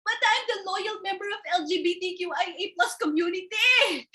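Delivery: a hint of an indignant complaint; mildly voiced Julie complaining. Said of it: "complained Julie".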